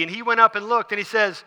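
normal talking voice

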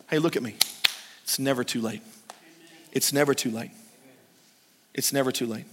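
low, soft and intimate; hushed, low comfort; tender reassurance. Acted. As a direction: lean in and reassure gently.

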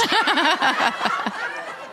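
short real laugh at his own joke; amused laugh. Real, not acted. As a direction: let a laugh slip out after the bit.

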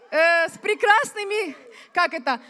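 usual speaking voice

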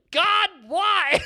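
loud, high wail up to heaven; hammed-up despairing yell, breaking into laughter; mock anguished cry.

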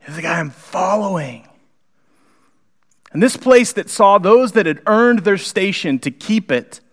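normal talking voice

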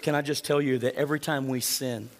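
normal talking voice

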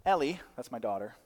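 ordinary talk, nothing marked

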